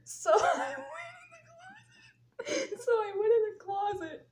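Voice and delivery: high, squeaky talk through real laughter; too tickled to get the sentence out; laughing. Real, not acted. Cracking up about walking into the closet, laughing. Cracks up telling her story.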